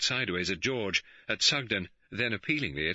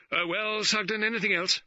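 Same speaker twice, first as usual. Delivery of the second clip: higher, brisk impatient prod; 4 dB louder than usual. A narrator's reading. Colonel Johnson hurrying Sugden along, impatient and higher. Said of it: "Colonel Johnson said impatiently".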